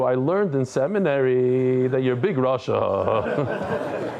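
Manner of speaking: mock daughter, low drawn-out announcement; slow, low mock accusation; imitated seminary daughter. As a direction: imitate a preachy daughter, stretching the words. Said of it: "she starts telling you".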